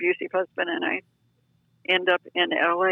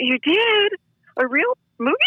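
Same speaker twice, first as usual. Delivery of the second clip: surprised; 8 semitones higher, 3 dB louder than usual. Retold or imitated. Retold surprise at friend's news.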